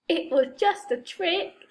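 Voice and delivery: plain reading voice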